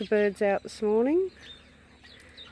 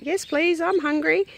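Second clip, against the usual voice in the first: lightly raised squeaky-high bird voice; parrot voice; 9 semitones higher, 4 dB louder than usual. Retold or imitated. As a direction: voice the hungry parrot, higher. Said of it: "He was like".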